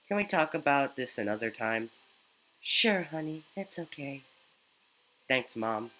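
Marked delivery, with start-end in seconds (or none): none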